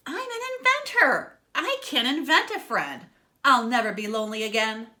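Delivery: high, loud, thrilled pig voice; excited. A narrator's reading. Inventor pig realising he can build a friend, excited high voice. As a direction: burst out with the big plan. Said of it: "Doctor Pig was excited".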